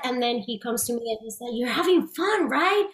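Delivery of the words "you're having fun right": much higher, cheerful imitation of a friendly director; encouraging director. Retelling Spielberg cheering her on while dancing, high and bright. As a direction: sing it up high like a delighted mentor.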